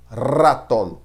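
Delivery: "ratón" with a strong trilled R, said loud; loud "ratón" with a trilled tongue R; rolled R. Shown as a demonstration. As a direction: roll the R of "ratón" hard. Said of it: "essa tremida na língua aí".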